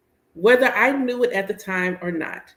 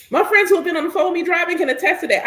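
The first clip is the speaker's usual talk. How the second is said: excited rant; 9 semitones higher, 4 dB louder, faster than usual. Real, not acted. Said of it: "I am an angry driver".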